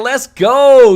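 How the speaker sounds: loud, upbeat hype shout; hype cheer. Real, not acted. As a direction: cheer the donor on. Cheering a super chat donor.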